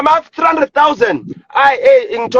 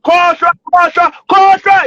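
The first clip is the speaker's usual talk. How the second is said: angry dismissal; 4 semitones higher, 3 dB louder than usual. Real, not acted.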